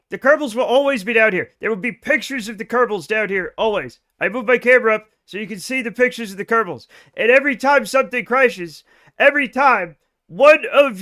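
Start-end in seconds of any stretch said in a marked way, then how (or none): none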